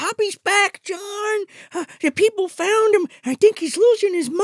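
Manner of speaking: high, loud hysterical phone call; mock frantic mother. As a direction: blurt alarming news as a hammed-up mom. Mom frantic on phone to husband.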